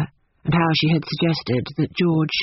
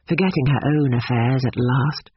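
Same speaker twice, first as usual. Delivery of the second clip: ordinary delivery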